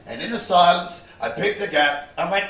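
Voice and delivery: usual speaking voice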